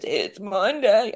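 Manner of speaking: slow, effortful imitation of someone barely able to talk; mimicked post-ventilator croak, drawn out; imitating labored speech. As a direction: drag the words out as if talking is a struggle. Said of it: "he could hardly talk".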